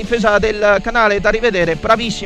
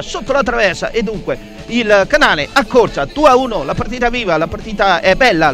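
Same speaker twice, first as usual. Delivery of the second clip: ordinary delivery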